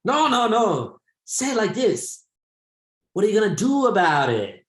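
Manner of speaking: put-on high Chinese-accented teacher voice, firm correction; Chinese-accented teacher demonstrating the phrase; teacher imitation. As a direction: mimic the Chinese English teacher showing the right way. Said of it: "And he's like".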